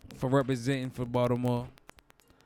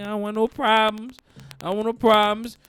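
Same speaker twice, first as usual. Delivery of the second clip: nervous plea; 6 semitones higher, 6 dB louder than usual. Retold or imitated.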